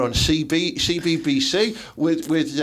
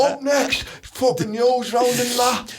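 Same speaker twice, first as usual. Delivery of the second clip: high, slow sing-song presenter link; mock presenter; 7 semitones higher, slower than usual. Joining the presenter parody, sing-song announcement.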